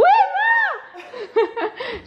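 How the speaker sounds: long, high-pitched laugh. Real, not acted.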